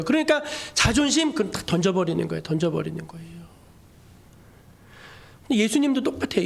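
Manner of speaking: ordinary talk, nothing marked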